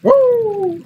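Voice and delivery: high, loud woo; excited whoop. Real, not acted. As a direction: cheer for yourself. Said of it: "I'm just excited about learning".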